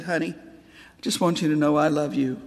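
tearful father. Retold or imitated. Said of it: "tears running down his cheeks".

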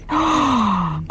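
a sharp shocked intake of breath; sharp real gasp on hearing the tale. Real, not acted. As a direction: gasp as if you can't believe it. Shocked at the story.

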